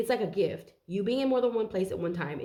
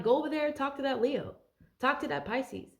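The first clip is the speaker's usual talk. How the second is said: higher-pitched brush-off quote; mock dismissal; 7 semitones higher than usual. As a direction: send them off to someone else. Acts out sending the crowd away.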